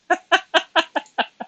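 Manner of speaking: very high squealing giggle; high squeaky giggle. Real, not acted. Laughing at her own joke, high squeal.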